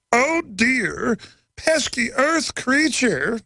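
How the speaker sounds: high, slow alien voice; squeaky space creature, drawn out; a cartoon alien talking down to humans; Martian imitation. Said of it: "I just did a Martian imitation".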